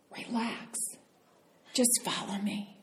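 hushed and soft, coaxing; soft whisper. Retold or imitated. Dance partner murmuring in her ear, soft and coaxing. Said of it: "He whispered in my ear".

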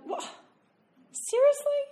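high, incredulous mock outrage; mock indignant. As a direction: squeak out the disbelief, hammed-up. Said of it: "for about ten minutes going".

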